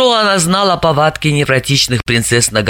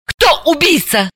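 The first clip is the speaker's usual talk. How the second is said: shot out; 8 semitones higher than usual. A narrator's reading. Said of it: "как выстрелила".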